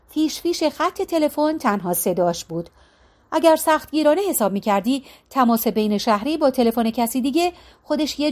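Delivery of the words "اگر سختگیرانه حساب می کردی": usual speaking voice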